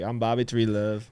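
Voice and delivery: put-on light Southern accent with a bit of twang; a hint of a Southern drawl introducing himself; mildly imitated Southern twang, relaxed. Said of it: "a little Southern accent with a little bit of twang".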